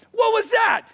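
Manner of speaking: high startled shout; startled cry. Retold or imitated. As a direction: call out in mock alarm. Reacting to explosion in kitchen.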